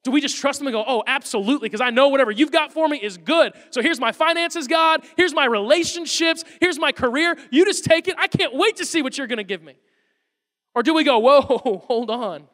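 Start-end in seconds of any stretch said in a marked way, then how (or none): 0.8-9.8 raised, energetic, acted enthusiasm; eager surrender. Acts out eager trust toward God. 11.2-12.5 loud, drawn-out, slow whoa; balking. Acted. Acts out hesitant believer stopping God.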